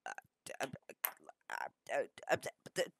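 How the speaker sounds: mock stuttering, lost for words; halting, acted-out speechlessness; dumbfounded stammer. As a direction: stammer on broken false starts. Acts out being lost for words.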